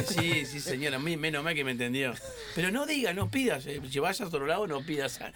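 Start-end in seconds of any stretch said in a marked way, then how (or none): 2.5-5.2 a hint of the Spanish grocer, higher; mild mock Galician warning; lightly voiced Spanish accent; Galician lady. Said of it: "Y dice así".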